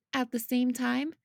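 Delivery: ordinary delivery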